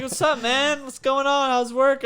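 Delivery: high, loud friendly guy greeting; mock cheery greeting. Coworker passing by, chipper mock greeting. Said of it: "He goes".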